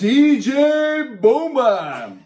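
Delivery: playful DJ name drop; hype announcer. Pretend. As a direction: boom it out like a club MC.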